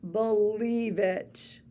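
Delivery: ordinary delivery, nothing marked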